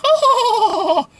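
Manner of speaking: high-pitched mock movie-line delivery; exaggerated, squeaky imitation of an actress; Anna Hathaway. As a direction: put on a very high voice, mocking the line. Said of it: "Anna Hathaway goes".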